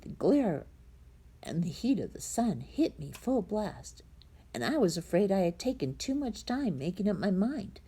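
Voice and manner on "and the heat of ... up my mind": ordinary delivery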